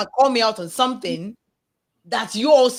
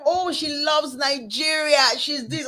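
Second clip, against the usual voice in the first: imitated gush, higher and drawn out; mock gushing; 6 semitones higher, slower than usual. Retelling a customer who loves Nigeria, mock gushing. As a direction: play up an overeager fan, stretching the words.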